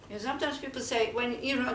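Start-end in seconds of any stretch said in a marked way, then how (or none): none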